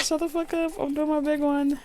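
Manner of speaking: high hammed-up diva squeal. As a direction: squeal it like an over-the-top diva. Repeats quote in squealed voice.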